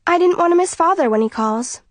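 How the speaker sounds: bright, upbeat lie; falsely cheerful daughter. A narrator's reading. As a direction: sound breezily cheerful. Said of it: "I say cheerfully".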